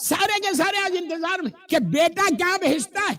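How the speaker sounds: high, forceful sermon delivery; preaching. Acted. Impassioned exhortation of congregation. (پرجوش خطیبانہ انداز)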